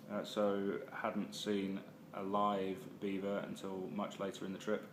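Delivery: ordinary delivery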